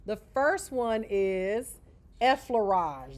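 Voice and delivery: slow, loud, deliberate announcement of effleurage; careful naming. Shown as a demonstration. Teacher introducing technique to students, slow deliberate naming. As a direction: say it slowly so students catch the term. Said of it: "That's how you pronounce it".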